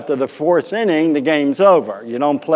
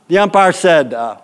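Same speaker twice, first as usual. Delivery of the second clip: ordinary delivery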